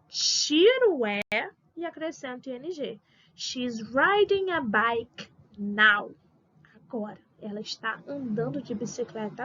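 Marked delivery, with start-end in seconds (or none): 3.3-6.1 very slow English example sentence; slow modelling. Shown as a demonstration. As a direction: draw each word out so learners follow. Teacher models example sentence slowly. (frase-modelo bem devagar)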